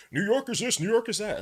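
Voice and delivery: high, fast whiny mimicry; high mocking whine. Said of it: "mocking people who complain about New York".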